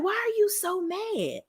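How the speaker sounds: high, drawn-out mock disbelief, softly; incredulous question. Host's reaction addressed to Portia.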